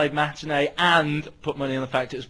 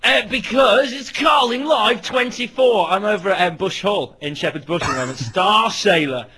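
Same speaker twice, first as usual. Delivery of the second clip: very excited; 5 semitones higher, 4 dB louder than usual. Real, not acted. Live reporter at the gig telling the studio, very excited. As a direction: gush it out in a wired rush of enthusiasm. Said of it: "You sound very excited".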